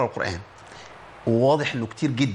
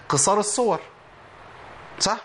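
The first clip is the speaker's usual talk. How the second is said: ordinary delivery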